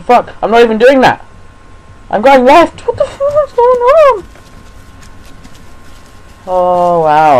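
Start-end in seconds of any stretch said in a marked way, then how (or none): 0.0-4.2 loud protest climbing to a shriek; frantic, frightened scream; panicked yelling. Real, not acted. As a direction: yell in rising panic, very high. Game moves against his will.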